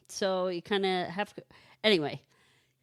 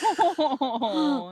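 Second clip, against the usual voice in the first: loud gasp; 8 semitones higher, 7 dB louder than usual. Retold or imitated. Voicing the boy's shame.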